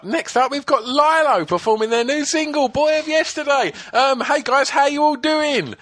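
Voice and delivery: very high, perky mock link; kids' TV presenter. Presenter introducing band on breakfast show, exaggerated perkiness. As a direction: parody an over-keen children's show host.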